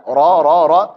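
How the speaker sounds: "ra" heavy and rounded, like "ro"; thick ra. Shown as a demonstration. Teacher restates the fathah rule, heavy "ra". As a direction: thicken each "ra". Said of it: "Ra fathah dibaca tebal".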